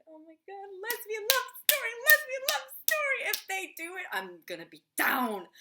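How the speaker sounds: very high, loud, excited squealing; fangirl squeal. Retold or imitated. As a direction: squeal it like a thrilled fan, ending on a loud drawn word. Reenacts her own fangirl reaction.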